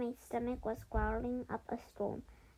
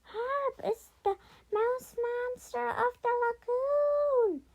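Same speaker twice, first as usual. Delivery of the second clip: high, loud, drawn-out cry of alarm; hammed-up panicked scream; 13 semitones higher, 7 dB louder, slower than usual. A narrator's reading. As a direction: scream it like a fleeing crowd. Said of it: "everyone ran off screaming".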